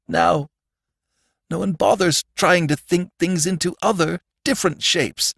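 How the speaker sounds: high-pitched sorrowful lament; tearful, trembling. A narrator's reading. Sandman standing over Robbie, starting to cry. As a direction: lament it on the edge of tears. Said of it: "beginning to tremble and cry".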